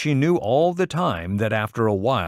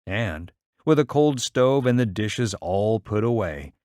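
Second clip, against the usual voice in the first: ordinary delivery, nothing marked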